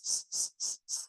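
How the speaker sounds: hissed "s" with no vowel, lips spread; bare s. Shown as a demonstration. Teacher isolating the sin sound. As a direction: hold a voiceless s. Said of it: "A-nya buang jadi s".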